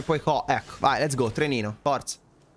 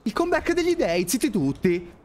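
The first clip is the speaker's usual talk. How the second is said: triumphant shout; 9 semitones higher than usual. Real, not acted. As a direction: crow over the comeback, much higher. Celebrating a comeback.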